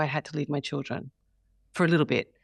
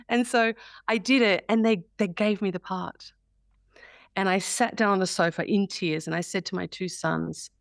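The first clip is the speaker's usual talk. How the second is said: normal talking voice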